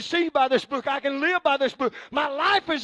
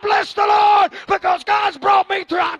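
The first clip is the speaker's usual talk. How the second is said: preaching building to full force; 4 semitones higher, 10 dB louder than usual. Acted. Preacher crying out his praise to the congregation. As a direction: build to a roaring climax.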